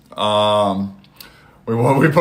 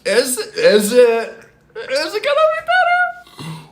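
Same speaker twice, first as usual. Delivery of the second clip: sarcastic disbelief; 12 semitones higher, 3 dB louder, slower than usual. Acted. Mocking the team's logic.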